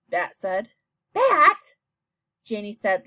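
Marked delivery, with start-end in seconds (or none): 1.1-1.6 a much higher, louder rebuke of his name; loud and sharp. Acted. Sister sharply calling out her brother, loud and sharp. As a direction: snap his name out in shock. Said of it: "loud and sharp".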